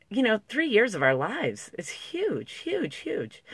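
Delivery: real choked-up voice, pitch climbing high; tears coming. Real, not acted. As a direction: let the voice swing up as tears rise. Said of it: "I can feel it coming".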